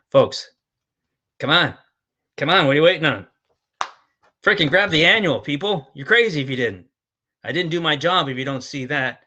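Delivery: loud, insistent "come on, people" pitch; urgent exhortation. Real, not acted. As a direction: urge them on at full voice. Urging viewers to buy now.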